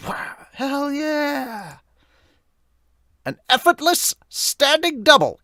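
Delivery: excited cheer. Acted. Voicing an excited manga character.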